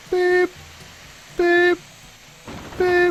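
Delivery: high mock reversing beeps. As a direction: squeak like a truck backing up. Backing up a vehicle, beeping.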